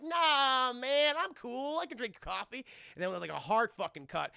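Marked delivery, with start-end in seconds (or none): 0.0-2.6 put-on cocky kid. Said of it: "It's like".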